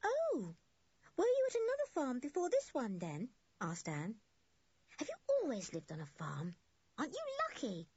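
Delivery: put-on high-pitched, wide-eyed little girl; curious young girl. A narrator's reading. Anne asks farm boy about his life, eager high voice. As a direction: ask with high girlish wonder.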